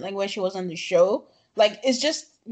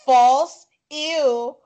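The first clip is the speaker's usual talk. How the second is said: loud quote; 3 semitones higher, 7 dB louder than usual. Retold or imitated. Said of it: "And she's like".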